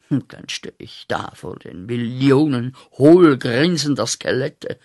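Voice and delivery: ordinary delivery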